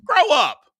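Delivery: high, loud "grow up"; scolding. Real, not acted. Host scolding a disrespectful caller, loud and high. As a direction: snap it at them like a parent.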